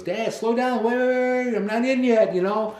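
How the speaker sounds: high, loud mock son voice calming his dad down; imitating his son. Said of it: "And he goes".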